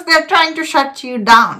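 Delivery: usual speaking voice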